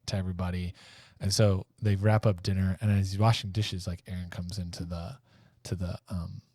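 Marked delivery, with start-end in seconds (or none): none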